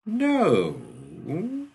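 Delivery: a long, drawn-out no; drawled. A narrator's reading. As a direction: stretch the vowel out lazily. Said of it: "Shaw drawled, extracting the vowel as slowly".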